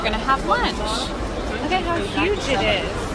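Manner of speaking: loud, bright, thrilled vlog talk; bubbling over with excitement; excited. Real, not acted. Excited vlog intro after champagne tour.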